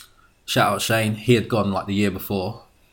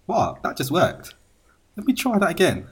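higher-pitched incredulous aside; mock surprise; 7 semitones higher than usual. Man finding his chat-up tactic works, mock surprise. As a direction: act out pleased disbelief. Acting out surprise at accent working.

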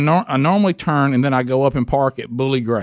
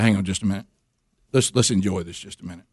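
softer, lower, savoring the moment; tender hush; 8 semitones lower, 6 dB quieter than usual. Retold or imitated. Husband asking his wife to savor making it, soft and low. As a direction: hold her back gently, lower and softer.

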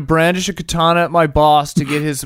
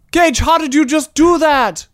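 amazed shout; 8 semitones higher than usual. Acted. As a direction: call out in astonishment. Amazed calling out to Gage.

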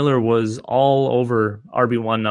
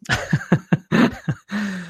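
rueful chuckle, higher than speaking voice; real laugh; 9 semitones higher than usual. Real, not acted. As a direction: laugh at your own bad call. Laughs at own wrong prediction.

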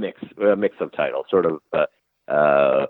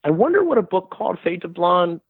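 high, wondering mock voice of a friend; musing friend; 11 semitones higher than usual. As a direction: play the partner idly dreaming up a plot. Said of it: "he said".

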